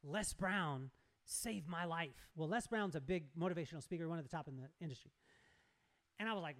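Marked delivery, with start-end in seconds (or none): 0.0-2.3 slow, earnest retelling of her words; dead serious. Retold or imitated. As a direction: imitate her slow, weighty confession. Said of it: "She gets really serious".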